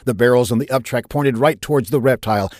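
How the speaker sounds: plain reading voice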